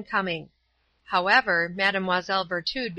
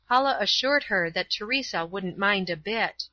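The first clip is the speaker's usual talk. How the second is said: ordinary delivery, nothing marked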